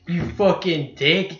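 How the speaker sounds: usual speaking voice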